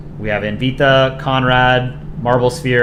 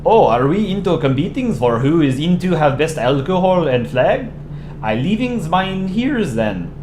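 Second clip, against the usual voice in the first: put-on guessed-at Danish accent, higher and sing-song; 4 semitones higher than usual. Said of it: "should I do a Danish accent".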